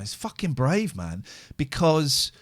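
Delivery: ordinary talk, nothing marked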